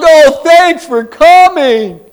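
high, loud, slow gushing welcome; gushing thanks. Retold or imitated. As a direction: lay the gratitude on thick. Effusive greeting to visiting speaker.

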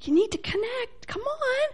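very high, soft coaxing; imitated pleading from heaven; heaven's gentle urging. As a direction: coax from far above, small and high. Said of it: "heaven's going".

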